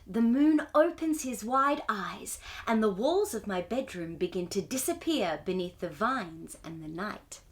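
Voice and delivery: ordinary delivery, nothing marked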